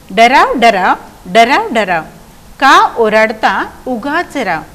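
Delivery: slow, drawn-out verse recitation; poem recitation. Shown as a demonstration. Teacher reciting the frog poem to pupils, slow recitation. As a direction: recite it in measured, stretched beats.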